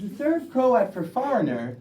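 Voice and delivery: ordinary talk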